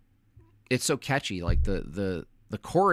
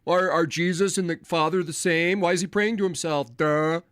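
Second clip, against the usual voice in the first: higher, faster mocking whine; mocking opponent voice; 5 semitones higher than usual. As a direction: mimic a smug know-it-all. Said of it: "and they go".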